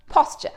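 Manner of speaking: "posture" restated with an open "pos" vowel; correct pronunciation. Shown as a demonstration. Teacher repeats the word for viewers, correct pronunciation of "posture". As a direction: say "posture" firmly as POSS-cher. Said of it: "not posture".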